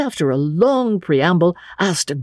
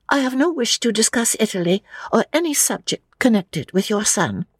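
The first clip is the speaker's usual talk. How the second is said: put-on higher-pitched, stiff young lady; prim refusal; 7 semitones higher than usual. Lucy refuses Emerson any talk of Italy, stiffly. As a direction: refuse firmly on a high, even voice.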